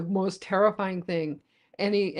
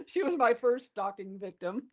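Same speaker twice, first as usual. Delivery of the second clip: laughing; 5 semitones higher, 4 dB quieter than usual. Real, not acted. Joking confession about stalking.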